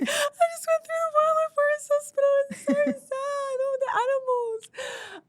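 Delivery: mock-sob the words in a strained high voice; imitating crying. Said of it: "I was in tears".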